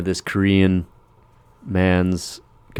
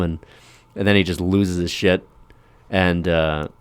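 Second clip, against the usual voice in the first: ordinary talk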